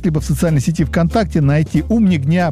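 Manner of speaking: usual speaking voice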